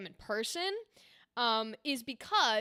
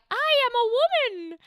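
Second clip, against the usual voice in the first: much higher, louder mock falsetto lady; fake woman voice; 12 semitones higher, 9 dB louder than usual. Shown as a demonstration. Voice actor mocking a put-on woman voice, falsetto. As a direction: ham up an exaggerated high woman's voice. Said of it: "put on a fake voice".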